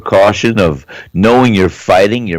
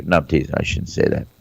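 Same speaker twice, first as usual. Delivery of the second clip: quiet aside; 8 semitones lower, 9 dB quieter, faster than usual. Real, not acted. Backing off joke quietly.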